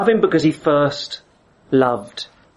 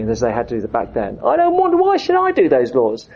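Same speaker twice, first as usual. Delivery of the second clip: put-on sulky kid. Kid whining to his dad about keeping the rules. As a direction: play a reluctant teenager pushing back. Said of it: "a kid says to his dad".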